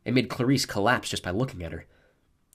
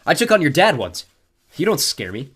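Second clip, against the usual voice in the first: defiant; 6 semitones higher, 9 dB louder than usual. A narrator's reading. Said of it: "keep my voice even".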